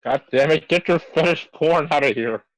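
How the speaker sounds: ordinary delivery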